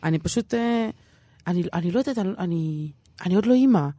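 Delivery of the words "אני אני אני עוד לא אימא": usual speaking voice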